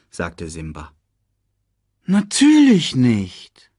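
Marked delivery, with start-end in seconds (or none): 2.0-3.7 slow, raised, drawn-out assurance; mock sympathy. A narrator's reading. Scar pretends to console Simba, drawling slowly. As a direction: stretch it out with false understanding. Uncle feigns comfort.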